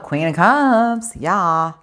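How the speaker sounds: excited reveal. Real, not acted. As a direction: savor the card name, thrilled.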